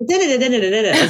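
high laughing monkey babble; monkey chatter. Retold or imitated. Feeling like a monkey for the host, laughing babble. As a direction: chatter like a performing monkey, giggling. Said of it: "like a monkey".

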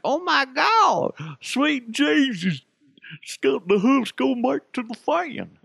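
high, slow, hammed-up shocked voice; mock gasping fan. Host mocking fans stunned by rumours, high hammed-up gasp. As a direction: gasp it out in a silly high falsetto. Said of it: "it's just funnier unless I say".

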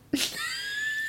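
high burst of nervous giggles; nervous laugh. Real, not acted. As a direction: giggle from nerves at the start. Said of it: "It's nervous laughter".

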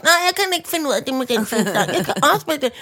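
high-pitched mock till voice; second checkout machine. Another till failing the same customer, imitated machine voice. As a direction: imitate a helpless machine refusing. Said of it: "Den sagde også".

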